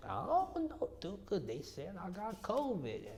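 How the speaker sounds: high, slow grandma voice; imitating grandmother. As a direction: put on a high little old lady voice. Voicing grandmother Cookie.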